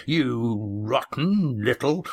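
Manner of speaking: slow, menacing half-growl; big man threatening the boy, slow; angry threat. Acted. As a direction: lunge at him with the words. Red Ian lunges at Yan.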